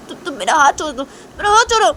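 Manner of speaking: high, loud burst of anger; angry demand. Acted. Shanaya breaking free from Tisha, angry and loud. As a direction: demand release, rising in pitch and volume.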